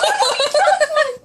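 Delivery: a loud, high burst of laughter. Real, not acted. Owner laughing at her own meowing, loud high laugh.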